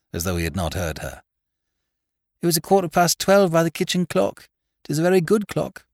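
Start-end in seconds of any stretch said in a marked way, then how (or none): 2.4-5.9 high, loud country woman testifying; high-pitched, emphatic rustic woman; stark farmer's wife. A narrator's reading. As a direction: insist on the facts as a countrywoman. Farmer's wife answering lawyer's questions.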